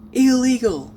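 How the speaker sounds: sharp, high, loud shout; loud yell. Retold or imitated. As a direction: yell it as loud as you can. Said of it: "as loud as possible".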